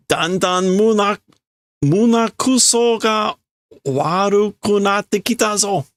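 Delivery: high-pitched scolding voice; scolding boss. Acted. Boss scolding idle workers, high-pitched scolding.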